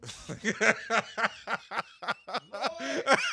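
loud, high, long cackling laugh; helpless laugh. Real, not acted. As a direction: burst out laughing at your own bad luck. Laughs at own bad luck.

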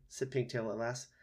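plain reading voice

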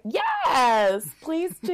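high, drawn-out flamingo cheer; over-the-top squawking encouragement; eager flamingo. Acted. Flamingo urges the lovers on, high gushing voice. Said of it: "a flamingo that's like".

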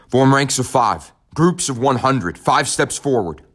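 orders over loudspeakers. Acted. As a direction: announce the commands through a PA system. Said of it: "The orders were given over the loudspeakers".

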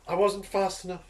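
loud hammed-up regret; mock anguish. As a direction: overplay the guilt, loudly. Player mock-grieving in character.

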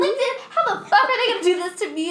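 high, fast, laughing mock outrage; indignant. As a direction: rant at LinkedIn half-laughing. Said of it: "I felt so indignant".

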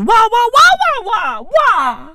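very high-pitched "wah wah" squawks; mock gibberish wailing. Comedian turning the accent into noise, squawking mimicry. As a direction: squawk it way up high, sliding down at the end.

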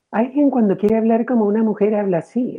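high put-on falsetto lady voice; cartoonish high mock female voice; mock woman voice. Shown as a demonstration. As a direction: ham up a man faking a woman. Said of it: "las mujeres no hablan así".

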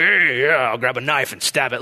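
lightly put-on tough-guy voice; mock masculine bravado. Preacher parodying the manly response he didn't have, mock bravado. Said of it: "my response wasn't the most masculine".

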